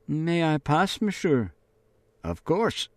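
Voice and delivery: lightly voiced girl asking to pass; frightened girl pleading. A narrator's reading. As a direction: ask to pass, small and scared.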